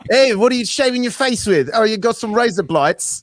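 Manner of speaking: high-pitched, playful Aussie character; Australian accent. Acted. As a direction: ask it as a high-voiced mock Australian. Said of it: "for an Australian version of razor blades".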